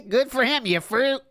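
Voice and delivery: mocking echo. Mocking the streamer's reply to a viewer, amused jeer.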